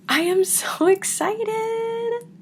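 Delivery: high, slow, giggling delight; thrilled high-voiced gush; giddy excitement. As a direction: let a laugh break into the words. Reading a viewer's excited comment.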